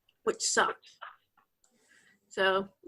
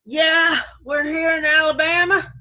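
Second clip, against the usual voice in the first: put-on acquired Alabama accent; 3 semitones higher, 4 dB louder than usual. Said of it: "within a year I was like".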